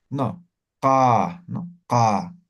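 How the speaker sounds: "ka" from the throat, without force. Shown as a demonstration. Teacher shows postvelar ka without forcing. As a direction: let "ka" come from the throat, no strain. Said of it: "desde la garganta pronuncias".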